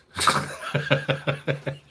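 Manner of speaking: laugh. Real, not acted. Laughing at his own joke.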